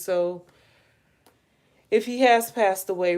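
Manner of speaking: normal talking voice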